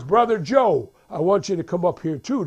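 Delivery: loud preacher calling a man forward; prédicateur. Retold or imitated. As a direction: summon him from the pulpit. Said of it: "then he says".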